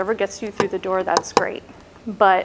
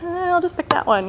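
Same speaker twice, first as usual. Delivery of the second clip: offhand shrug; 8 semitones higher than usual. Retold or imitated. Acts offhand random choice from list.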